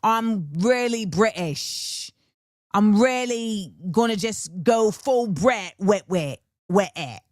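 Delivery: low, hammed-up British accent; exaggerated British accent. Imitating a British rapper, exaggerated British accent. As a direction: lean hard into a mock British accent. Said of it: "she's really leaned into".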